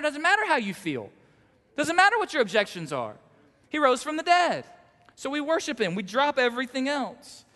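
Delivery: loud, high, fast full-throated preaching; preaching at full force. Acted. Pastor driving home the point to his congregation, preaching at full force. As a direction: preach it out so the whole room feels it.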